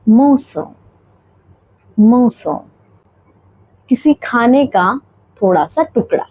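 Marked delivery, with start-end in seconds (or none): 0.1-2.5 मोसो with the R dropped, like maw-sul; British morsel, no R; British pronunciation. Shown as a demonstration. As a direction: say morsel non-rhotic. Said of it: "इसलिए हम इसे pronounce करेंगे".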